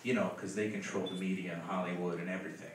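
quiet, low pitying murmur; hushed sympathy. Retold or imitated. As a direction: lean in and say it quietly. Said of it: "He goes".

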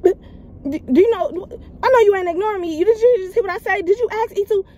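high pitched, fast mocking yell at the top of her lungs; shrill yelling. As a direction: imitate the babysitter screaming at you. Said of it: "yelling at the top of her lungs".

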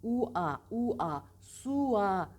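U-A with a sharp glide from U into A; sharp vowel transition. Shown as a demonstration. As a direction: demonstrate U-A as two distinct vowels. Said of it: "burada da yine keskin geçiş var".

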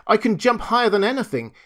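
high, fast, loud boast; boastful little rabbit. A narrator's reading. Toy rabbit defending himself to furry rabbit, rushed boast.

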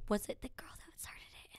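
soft whisper to oneself; retold hushed musing. Woman retells her thought about the birds, whispering. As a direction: whisper the wondering question.

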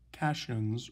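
"cations" said as CASH-unz; mistaken pronunciation. Shown as a demonstration. Teacher showing students a misreading from spelling, "cations" mispronounced CASH-unz. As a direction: say "cations" to rhyme with "rations", short a. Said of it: "might be pronounced".

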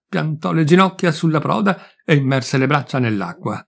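ordinary delivery

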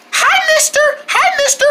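very high squeaky little voice; little friends greeting. Pretend. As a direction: pipe a greeting in a tiny voice.

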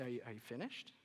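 low, soft, unruffled question; quietly wait out the outburst; hushed, patient check-in; patient response. Acted. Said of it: "Jesus will listen".

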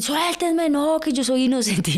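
mock protest. Retells protesting in police van.

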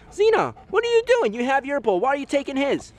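squeaky surprised scolding voice; high playful telling-off; high-pitched scolding. Real, not acted. Scolding dog stealing bowl.

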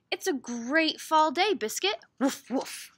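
loud, lively storybook read; animated picture-book voice; reading to children. Acted. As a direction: read big and high for little listeners. Reads picture book aloud.

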